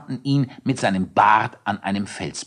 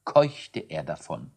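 ordinary delivery

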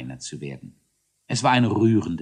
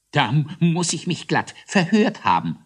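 raised pitch, mild amazement; astonished; 8 semitones higher, 4 dB louder than usual. A narrator's reading. Mogler doubts what he heard in court, astonished. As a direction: wonder aloud in astonishment. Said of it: "staunte Mogler Kinkelwitz".

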